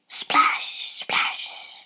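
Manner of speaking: hushed, voiceless splash effect; whispered splashes. A narrator's reading. Hunting dogs in the swamp, whispered splashing sounds.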